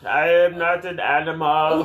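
slow, hammed-up cry of protest; mock-dramatic outcry. Co-host sending up the Frankenstein talk, mock-dramatic. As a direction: proclaim it slowly with mock anguish.

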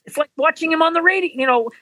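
high, quick mock-dismissive voice; a lightly voiced unimpressed wife; imitating his wife. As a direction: scoff at ventriloquists like she does. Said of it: "She goes".

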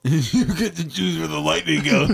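repeated through laughter; giggling the line out; laughing. Real, not acted. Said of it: "I like that inspirational quote".

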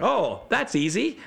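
high, drawn-out singsong ease; breezy overconfidence. Acted. Own overconfident past self acted.

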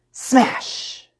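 sudden loud burst on "smash"; explosive smash. A narrator's reading. Narrator sounding the door being hit, explosive. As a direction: make the word crash.

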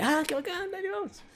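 high, soft, overdone excitement; pretending to be hyped; mock excited. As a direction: fake thrilled agreement. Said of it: "Así súper emocionado".

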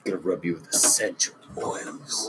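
sultry whisper. Pretend. Mock seduction game.

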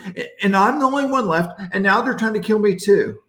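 high, laughing, fast self-pitying whine; whiny complaint. Acted. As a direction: rattle off the grievances like a sulking prophet. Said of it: "he starts his complaint track".

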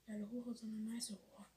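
a hushed aside; whisper. Real, not acted. Joking to a friend in a sly whisper. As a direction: breathe it out as a secret joke.

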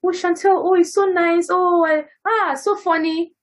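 high, gushing mock admirers; sugary mock praise; gushing fans. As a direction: imitate fans fawning over her. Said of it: "people just go".

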